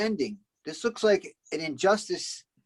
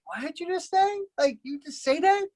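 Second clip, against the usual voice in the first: higher-pitched, questioning disbelief; mock incredulous; 7 semitones higher than usual. Imitating a reaction to what Joker just said, mock incredulous. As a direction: act out being taken aback, lightly.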